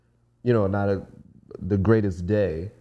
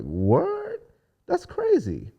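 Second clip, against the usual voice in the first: high, squeaky disbelief; high-voiced mock astonishment; retold amazement; much slower than usual. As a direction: act out being floored, pitched way up. Retells own amazed reaction.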